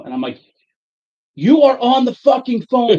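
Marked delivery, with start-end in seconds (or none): 1.4-3.0 high, loud, slow furious outburst; angry and loud. Retold or imitated. Irate customer to clerk on the phone, loud and angry. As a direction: hammer each word out at full voice. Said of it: "now I'm loud".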